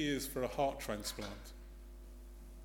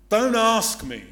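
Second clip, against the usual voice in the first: loud, higher exasperated cry; indignant outburst; 6 semitones higher, 13 dB louder than usual. Acted. Peter indignant at Jesus, loud exasperated retort. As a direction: snap back, fed up. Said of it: "Peter's indignant".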